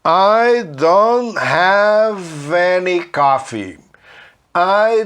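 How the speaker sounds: don't said "dõ", no T, slow and drawn out; silent T in don't. Shown as a demonstration. Teacher modelling slow linked English, silent T. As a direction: drop the T of don't, slowly. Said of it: "não fala esse T".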